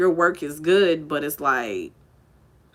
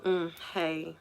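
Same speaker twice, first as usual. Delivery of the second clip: cold, offhand, much softer; dismissive greeting; 12 dB quieter than usual. Retold or imitated. Snobby idol coldly greeting the fan, mock dismissive. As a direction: brush her off with a bored little hey. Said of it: "that's literally the look that she gave me".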